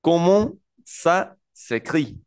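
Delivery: very slow "Comment ça s'écrit?"; slow French demo. Shown as a demonstration. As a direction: drag out "Comment ça s'écrit?" syllable by syllable. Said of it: "la tercera es". ("Comment ça s'écrit?" muy lento)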